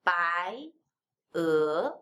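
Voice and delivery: "Bai, e" each starting low and rising; rising second tone. Shown as a demonstration. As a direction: glide up on both "Bai" and "e". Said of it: "how to make it the second tone".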